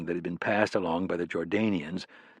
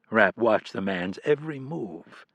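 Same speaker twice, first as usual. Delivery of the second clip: ordinary delivery, nothing marked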